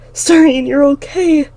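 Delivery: high, loud overjoyed cry; tearful relief. A narrator's reading. Girl hugging her sister, relieved she is alive. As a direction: sob out the relief.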